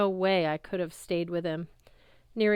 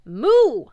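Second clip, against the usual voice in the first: high, very loud moo; 7 semitones higher, 14 dB louder than usual. Acted. The Ophiotaurus answering the friends with a loud moo. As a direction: let out a loud sharp moo. Cow serpent mooing.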